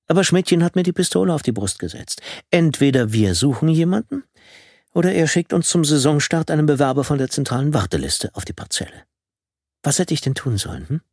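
höhere, rechtfertigende Frauenstimme; Frauenstimme Tine. A narrator's reading. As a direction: verteidigt sich, deutlich höher. (höhere Frauenstimme, sich rechtfertigend)

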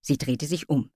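ordinary delivery, nothing marked

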